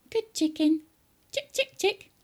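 put-on very high, cajoling boy; high-pitched, wheedling George; coaxing a hen. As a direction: lure the chicken with high little calls.

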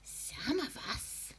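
high, slow, breathy throaty whisper. A narrator's reading. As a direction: lean in and whisper hoarsely. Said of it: "whispering in a throaty sort of voice".

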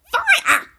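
high, piercing cry of alarm; old woman's yell. A narrator's reading. Old woman raising the fire alarm, yelling shrilly. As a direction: yell it at the top of your pitch. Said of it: "the old woman yelled".

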